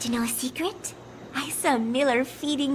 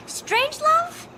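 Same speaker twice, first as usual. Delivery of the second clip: surprised; 13 semitones higher, 3 dB louder, slower than usual. Acted. Surprised at who took photo.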